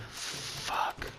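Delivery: a breathless whispered swear; whispered curse. Retold or imitated. Watching the video beside his girlfriend, whispered curse. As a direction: hiss it under your breath, stunned. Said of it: "and I'm like".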